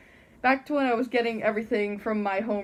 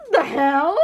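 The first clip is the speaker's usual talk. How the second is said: shocked the hell; 4 semitones higher, 8 dB louder than usual. Retold or imitated. Shocked at teacher's phone voice.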